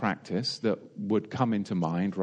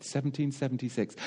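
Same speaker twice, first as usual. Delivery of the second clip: ordinary talk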